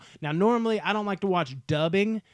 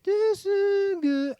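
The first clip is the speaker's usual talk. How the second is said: high, drawn-out eerie musical cue; badly imitated horror-score wail; imitated film music; 3 dB louder, much slower than usual. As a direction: mimic a creepy score, high and slow. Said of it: "can't even do a good impression".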